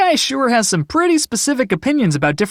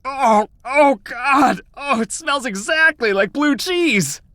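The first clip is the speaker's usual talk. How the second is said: disgusted; 5 semitones higher, slower than usual. Acted. Picks up smelly object.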